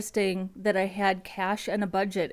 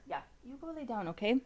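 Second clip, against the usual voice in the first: much softer, quick and gentle to the puppy; soft dog talk; 3 semitones higher, 6 dB quieter, faster than usual. Real, not acted. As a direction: shoo the dog off to bed softly. Sending the dog to lie down.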